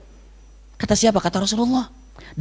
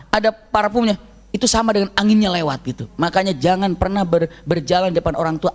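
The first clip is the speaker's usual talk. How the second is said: ordinary talk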